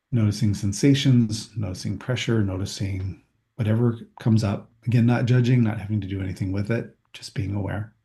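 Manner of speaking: walk them through their toes, unhurried; calm body scan meditation voice; guided body scan. Acted.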